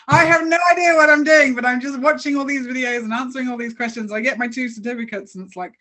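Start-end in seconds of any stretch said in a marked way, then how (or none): 0.1-4.1 laughing disbelief. Retold or imitated. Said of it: "I'm just like".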